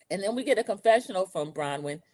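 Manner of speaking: ordinary talk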